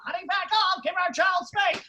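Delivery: high, loud squawking parrot copying the nag; parrot mocking. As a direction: screech the nag back like a parrot. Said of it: "Then he starts mocking my wife".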